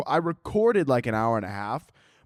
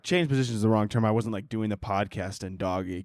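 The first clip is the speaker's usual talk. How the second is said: ordinary talk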